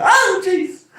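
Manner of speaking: high, loud mock yelp of pain. Kid yelping as grandma strikes with Bible. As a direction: yelp as if just struck.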